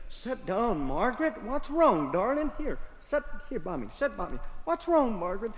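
calm her down gently; soothing husband. Acted. George calming his upset wife Margaret, soft and soothing.

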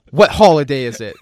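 loud, high, mock-aggressive "What holiday is it?"; mock customs officer. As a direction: bark it like a suspicious border agent. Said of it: "a British guy at U S Customs".

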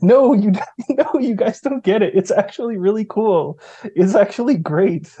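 high-pitched, laughing, insistent protest; defensive excited retort. Retold or imitated. Defending the Greek accents to online gamers, high and excited. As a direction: squeal back that it's actually cool. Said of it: "And being like".

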